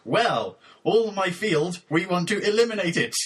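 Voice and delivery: deadpan lyric recitation. Acted. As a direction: recite it solemnly, phrase by stilted phrase.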